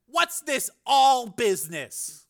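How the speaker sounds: high, slow insistent challenge; demanding. A narrator's reading. Frank questioning his raving brother, demanding. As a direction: demand an answer, drawing it out. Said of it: "Frank demanded".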